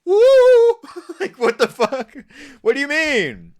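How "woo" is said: high, loud yelp of a hoot; shrill mocking woo. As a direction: hoot it like a clueless roommate. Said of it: "You don't have to be like".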